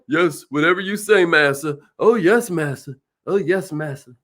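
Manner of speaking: low, fawning yes-master voice; subservient servant. Retold or imitated. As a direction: grovel like a servant, dropping low. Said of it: "There's subservient".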